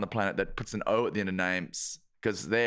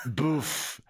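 French scoff; 2 semitones lower than usual. Pretend. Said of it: "Mister Frencho".

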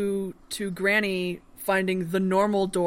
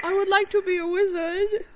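put-on high little girl voice; child asking earnestly; adult faking child voice; 12 semitones higher, 4 dB louder than usual.

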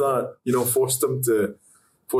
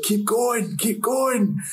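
shouting; 13 semitones higher, much slower than usual. Retold or imitated. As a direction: shout it across the set. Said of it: "they were just like".

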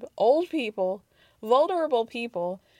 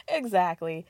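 ordinary talk

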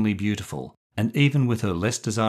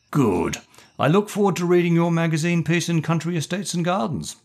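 put-on higher, eager old colonel; enthusiastic; 10 semitones higher, 4 dB louder than usual. A narrator's reading. Colonel thanks the visiting writer for the article, enthusiastic. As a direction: brighten up at the thought of the magazine piece. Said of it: "the Colonel responded enthusiastically".

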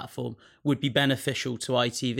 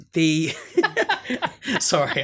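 breaking into laughter mid-sentence; can't keep a straight face; laughing; 6 semitones higher, 7 dB louder than usual. Real, not acted. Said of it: "I can't say it with a straight face".

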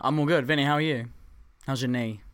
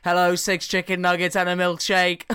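higher, louder stiff robot order; 5 semitones higher, 6 dB louder than usual. Retold or imitated. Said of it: "starts talking like a robot".

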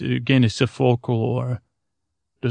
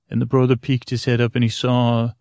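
plain reading voice

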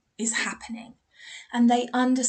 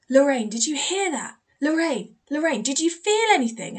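higher, louder excited questions; retold urgent calling; 5 semitones higher, 5 dB louder than usual. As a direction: call to a friend, re-enacting your amazement.

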